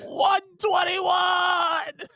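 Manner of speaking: very slow, high drawn-out shout; excited announcer shout. Pretend. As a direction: stretch every word in a high shout.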